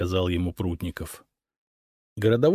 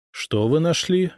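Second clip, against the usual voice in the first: ordinary delivery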